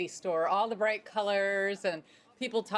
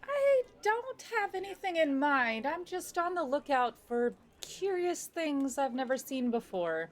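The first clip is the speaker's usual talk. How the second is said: curious lady; 7 semitones higher than usual. Acted. Player as lady.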